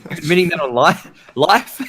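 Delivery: high laughing tease. Real, not acted. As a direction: laugh through the words. Teasing, laughing.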